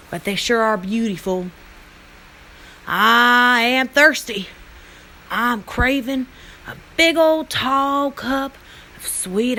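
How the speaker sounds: put-on drawling Western cowboy accent, vowels stretched out; hearty cowboy twang, calling folks over. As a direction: play a big cowboy drawl. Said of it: "Picture a cowboy ... He might say something like".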